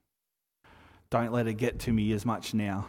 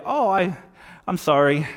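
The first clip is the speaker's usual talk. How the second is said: higher, louder insincere sorry; put-on apology, pitched up and pushed; mock apology; 6 semitones higher, 6 dB louder than usual. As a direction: toss out a loud, hollow apology. Mock apology from someone mean.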